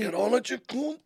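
yelling at game. Real, not acted.

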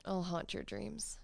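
slow soft ghostly tease; spooky sultry. Pretend. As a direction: breathe it like a ghost. Spooky seductive reply.

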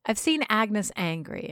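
plain reading voice

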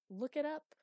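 high, loud distant call; unsympathetic call back. A narrator's reading. Master answering Chloe from below, calling out. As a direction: shout a brusque answer back. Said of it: "floating back to her".

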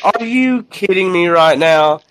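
high, slow mock disbelief; retold exasperation. Recruiter to stoned recruit, retold exasperation. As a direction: draw it out in imitated exasperation. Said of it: "I was so mad".

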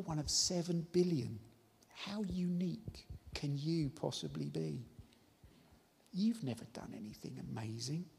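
soft, breathy hissing voice of the tempter, mostly whispered; a sly, quiet devil voice, well below the preacher's own; tempting whisper. Acted. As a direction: coax doubt into their ear like the devil. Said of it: "he is trying to convince people by whispering".

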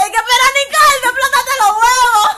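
quoting her friend's disbelief while laughing; friend's amazed voice. Retold or imitated. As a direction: voice the astonished friend. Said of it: "Me dice ella".

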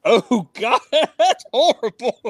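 high, loud, laughing outburst; helpless laughter through the words; real laughing dismay. Real, not acted. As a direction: crack up while groaning at an awful choice. Cracking up at the quarterback choice.

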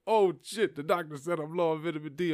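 high, startled imitation of dad; mock dad panic. Dad reacting to doctor.

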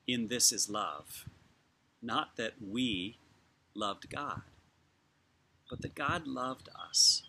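measured, unhurried reading, phrases left to settle; slow scripture reading. Real, not acted. Preacher reading First John to congregation, slowly, with long pauses. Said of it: "I will read it slowly".

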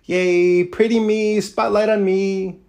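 high, drawn-out preening falsetto; mock someone showing off in the spotlight; slow, airy, sing-song high voice of self-admiration; mock attention seeker. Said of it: "trying to be like".

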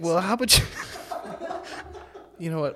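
high, loud, laughing scolding; mock stern doctor. Doctor scolds patient about soda, laughing mock-scold. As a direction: play the exasperated doctor through laughs. Doctor voiced in retold story.

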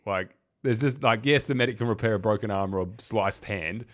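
ordinary talk, nothing marked

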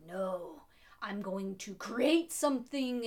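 mock-grandiose outburst, building in force; impassioned declaration. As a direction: proclaim it like a driven scientist. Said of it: "but then it's like".

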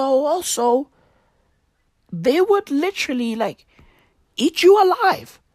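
ordinary talk, nothing marked

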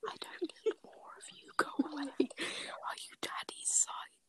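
whispering. Real, not acted. S1 whispering, parents asleep.

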